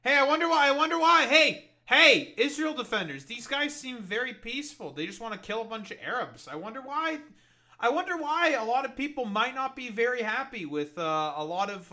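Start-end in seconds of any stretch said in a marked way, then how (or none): 0.0-9.5 high, loud sing-song mockery; sarcastic taunt. Real, not acted.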